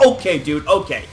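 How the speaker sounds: low, resigned okay dude; deadpan disbelief; exasperated okay. Retold or imitated. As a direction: let it drop flat and low, giving up. Reacting to the match, deadpan.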